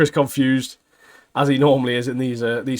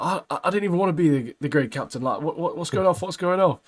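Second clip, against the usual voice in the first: higher-pitched mock rant at ordinary volume; retold complaint, pitched up and agitated; imitated yelling; 6 semitones higher than usual. Confused new captain protesting to his mates, mock yelling. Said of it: "starts yelling about how it isn't hard".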